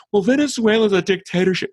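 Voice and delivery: high, prim mock voice of a smug liberal. Mocking so-called liberals who lecture him, in a high smug voice. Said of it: "so-called liberals telling me with a straight face".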